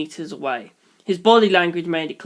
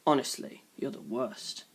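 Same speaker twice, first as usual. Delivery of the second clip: much softer, lower muttering; muttered; 5 semitones lower, 12 dB quieter than usual. A narrator's reading. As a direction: mutter it under your breath. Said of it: "I heard him mutter".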